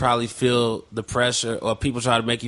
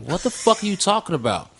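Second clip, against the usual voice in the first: higher-pitched incredulous retort; exasperated retort; 5 semitones higher than usual. Retold or imitated. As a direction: act out an exasperated comeback. Retelling his exasperated reply to friend.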